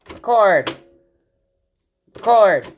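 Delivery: normal talking voice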